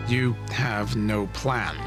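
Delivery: slow, crisp, every syllable placed; crisp measured syllables. Acted. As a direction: measure each word coldly. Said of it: "speaking in crisp, measured syllables".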